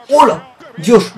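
sudden high squeal of surprise; startled yelp. Real, not acted. Gamer startled by a lucky tackle, high yelp. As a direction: let a sharp little shriek slip out. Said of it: "vaya gritillo he soltado ahí".

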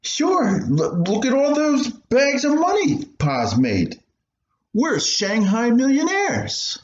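put-on a kid bragging about Papa's money; proud boy. Boy boasting to his mother about Papa.

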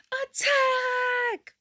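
high shrieking battle cry; battle cry shout. Pretend. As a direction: scream it like charging into battle. Commanding dog to attack.

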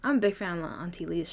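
ordinary talk

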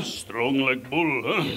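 very slow, heavy mock Eastern European accent. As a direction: boast like a cartoon Eastern European strongwoman. Mock foreign muscle woman.

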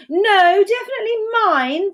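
high, loud, insistent bellow; high-pitched, emphatic contradiction; bellowed claim. A narrator's reading. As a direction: shout down a rival. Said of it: "bellowed Dora".

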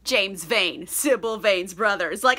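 ordinary talk, nothing marked

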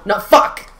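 angry outburst. Real, not acted. Gamer killed again, cursing loud. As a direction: snap as he dies.

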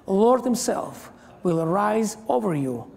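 ordinary talk

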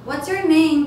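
teacher modelling the question; modeled question. Shown as a demonstration. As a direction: ask it clearly to the class. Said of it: "teacher models question".

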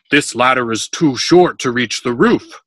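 loud, emphatic complaint; grumbling. A narrator's reading. Workman arguing over a too-short ladder, grumbling. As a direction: complain forcefully about shoddy work. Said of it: "Pepros grumbled".

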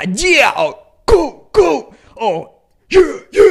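slow, stretched, strangled "yeah" and "cool"; squeezed, isolated sample hits. Shown as a demonstration. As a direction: drop each word like a DJ sample. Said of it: "como un sample".

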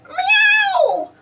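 very high, drawn-out annoyed miaow; a cat put out at being stepped over; grumbling cat. A narrator's reading. Pilchard grumbling as Bob steps over her. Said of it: "grumbled Pilchard".

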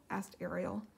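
ordinary delivery

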